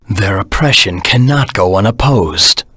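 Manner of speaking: ordinary talk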